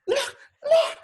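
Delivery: very high, urgent squeal; frantic rabbi. Retold or imitated. Rabbi pointing out the mess, frantic high squeal. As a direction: squeal it in a panic, imitating him. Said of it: "He's like".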